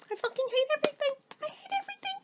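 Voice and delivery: high, fast, exasperated squeal of despair; half-laughing mock meltdown; exasperated rant. Real, not acted. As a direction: push it up high and fast, fed up with everything. Venting about no soap.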